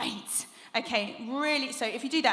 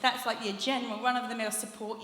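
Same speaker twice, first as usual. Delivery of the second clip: ordinary talk, nothing marked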